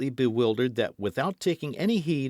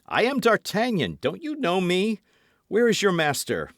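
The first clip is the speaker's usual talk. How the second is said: urgent; 9 semitones higher, 5 dB louder than usual. A narrator's reading. Urgent agitated demands.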